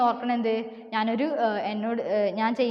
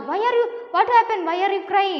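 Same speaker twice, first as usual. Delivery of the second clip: high, loud storybook question; startled question; 8 semitones higher, 4 dB louder, much slower than usual. Acted. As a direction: voice the crocodile's startled concern. Crocodile asks his crying wife. (ഉയർന്ന സ്വരത്തിൽ പകച്ച ചോദ്യം)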